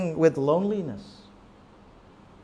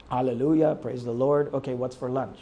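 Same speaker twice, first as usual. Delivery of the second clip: feelingless prayer; 6 semitones lower than usual. Retold or imitated. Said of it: "There's no feeling in it".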